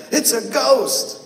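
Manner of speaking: high, slow frightened cry of a ghost sighting. A narrator's reading. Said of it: "they were terrified and said".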